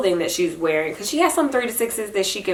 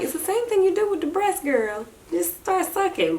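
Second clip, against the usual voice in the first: higher, playful coaxing; coaxing baby talk; 11 semitones higher, 4 dB quieter than usual. Retold or imitated. Mother retelling coaching her baby with the straw. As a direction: cheer the baby on. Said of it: "and I'm like".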